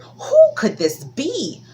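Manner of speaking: drawn-out curious spider voice; Anansi wondering. Acted. Anansi wondering who knocks, high and slow.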